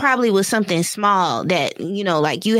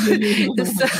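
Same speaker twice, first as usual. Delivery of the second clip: higher voice breaking into giggles; laughing; 6 semitones higher than usual. Real, not acted. Caller defending her therapist to the host, laughing. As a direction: talk through a laugh, pitched up.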